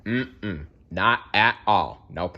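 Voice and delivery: plain reading voice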